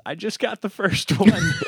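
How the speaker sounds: loud high line broken by a laugh; cackling blonde. Acted. The blonde cackles to God at the last step. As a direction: blurt it out while cackling.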